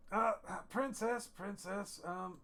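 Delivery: hesitant tipsy wheedling, pitched well up; drunk dwarf. Acted. Drunk dwarf trying to get the princess's attention, tipsy calling. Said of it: "He goes".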